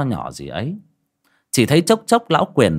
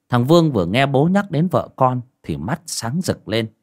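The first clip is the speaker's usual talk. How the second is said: ordinary delivery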